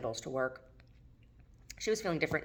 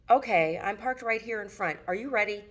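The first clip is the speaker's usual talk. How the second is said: yelled back; 6 semitones higher, 3 dB louder than usual. Acted. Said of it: "he yelled back through the door".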